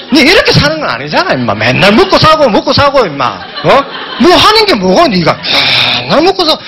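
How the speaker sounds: a hammed-up drunk father nagging; mock drunken scolding. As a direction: play the tipsy dad scolding his pet, half laughing. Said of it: "아버지가 어릴 때 술 드시고 오신 걸 제가 하고 있대요".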